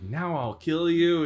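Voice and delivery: mock threat. Ice King menaces the boys, slow and lightly villainous. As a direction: draw out the threat like a cartoon villain. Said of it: "Ice King's like".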